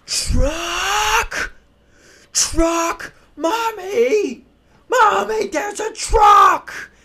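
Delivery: put-on very high, squealing little kid; excited toddler voice. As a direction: shriek like a toddler seeing a truck. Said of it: "He starts going".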